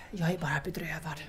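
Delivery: quiet, crumbling sorrow, words hurried out; heartbroken. Acted. Bianca tells Sartre how crushed she is, quiet and crumbling. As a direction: let the voice fall away in grief.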